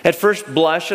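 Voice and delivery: ordinary talk, nothing marked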